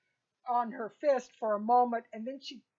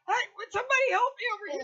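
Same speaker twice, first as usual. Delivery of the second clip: calling for help; 10 semitones higher, 5 dB louder, much faster than usual. Acted. GM voices character calling for help.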